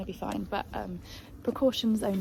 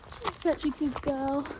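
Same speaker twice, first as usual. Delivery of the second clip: normal talking voice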